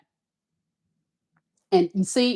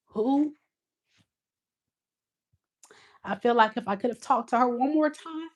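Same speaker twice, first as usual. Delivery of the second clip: crying; 5 semitones higher, 4 dB quieter than usual. Real, not acted. She remembers her late friend, tearful and breaking. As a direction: voice breaking up high through tears. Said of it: "I'm crying".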